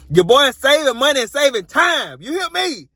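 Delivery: sing-song, high catchphrase brag; playful hype. Pretend. Playful hype of own saving.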